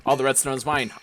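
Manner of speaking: normal talking voice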